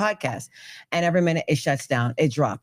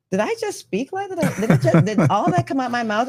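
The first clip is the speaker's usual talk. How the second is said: high-pitched, incredulous self-questioning; mock disbelief; 8 semitones higher than usual. Reacting to own words dictated into AI, high mock disbelief.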